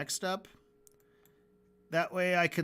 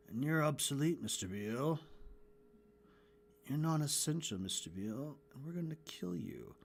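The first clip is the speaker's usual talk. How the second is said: softer audition read; 2 semitones lower, 8 dB quieter than usual. Retold or imitated. Animator testing Alex's faces, with a softer, fading read. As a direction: try the line soft and menacing.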